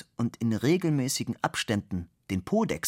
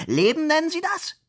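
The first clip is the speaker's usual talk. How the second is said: much higher, louder, scandalised cry; indignant outburst; 6 dB louder than usual. Acted. Director scolds Fabian, indignant high loud outcry. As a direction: shriek it in outrage.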